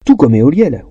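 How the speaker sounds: usual speaking voice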